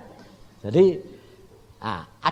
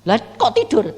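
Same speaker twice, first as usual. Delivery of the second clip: indignant question; 11 semitones higher, 10 dB louder than usual. Retold or imitated. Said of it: "indignant question to God".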